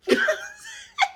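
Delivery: very high burst of laughter; squealing laugh. Real, not acted. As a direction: cackle high. Laughing at scene.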